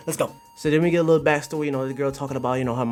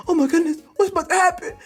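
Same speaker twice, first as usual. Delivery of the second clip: high, squeaky imitation of a girl; mock-innocent alarm in falsetto; startled girl impression; faster than usual. Streamer voicing the manga girl as slime nears, high and startled. Voices the manga girl's reaction.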